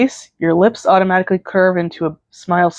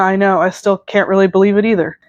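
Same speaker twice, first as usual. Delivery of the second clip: plain reading voice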